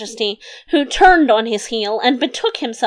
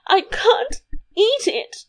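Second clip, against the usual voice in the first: much higher, slow wail; tearful queen; 11 semitones higher, slower than usual. A narrator's reading. Queen complains to king over breakfast, tearful high whine.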